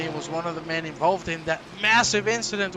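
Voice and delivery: ordinary talk, nothing marked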